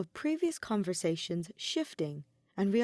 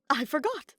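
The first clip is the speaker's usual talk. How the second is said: higher, bright burst of remembering; excited higher exclamation of sudden memory; sudden excitement; 7 semitones higher than usual. A narrator's reading. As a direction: blurt it out as it hits him. Ferdinand suddenly remembers the ring.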